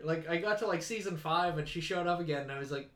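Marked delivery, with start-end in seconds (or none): none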